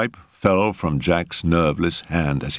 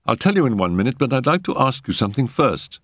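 put-on higher-pitched, earnest young man; anxious young patient; 7 semitones higher, faster than usual. A narrator's reading.

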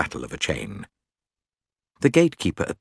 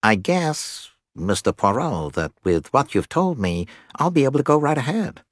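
pleasant, nasal American-style character voice; nasal and agreeable, rising higher at the end; pleasant nasal accents; 7 semitones higher than usual. A narrator's reading. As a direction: talk pleasantly through the nose. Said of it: "He said in pleasant nasal accents".

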